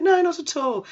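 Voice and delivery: breezy denial. Retold or imitated. Girlfriend brushing off the jealousy question, very high and airy. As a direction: brush it off brightly. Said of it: "And she's like".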